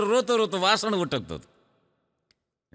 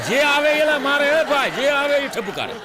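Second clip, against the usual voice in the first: comic outburst; 6 semitones higher than usual. Acted. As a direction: shout the joke about the bell. Punchline about temple bell. (ઊંચા અને મોટા અવાજે મજાકિયો પંચ)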